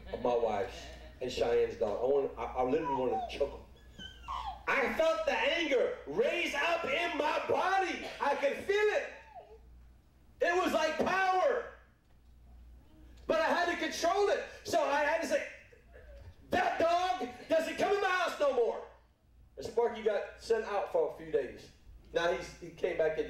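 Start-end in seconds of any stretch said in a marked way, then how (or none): 4.7-11.6 high, slow, forceful testimony; preacher's raised, stretched-out cry; impassioned preaching. Real, not acted. Preacher relives rising anger. 13.3-18.8 high-pitched, emphatic testimony; preacher in full flight; impassioned preaching. Real, not acted.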